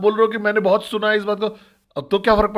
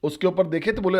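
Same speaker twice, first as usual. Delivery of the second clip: usual speaking voice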